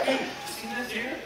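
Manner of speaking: ordinary talk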